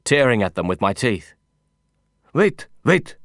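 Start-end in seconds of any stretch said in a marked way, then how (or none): 2.3-3.2 higher, sharp cry to stop; accented, high-pitched urgent plea. A narrator's reading. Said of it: "Mahmoud cried".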